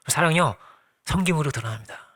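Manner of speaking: normal talking voice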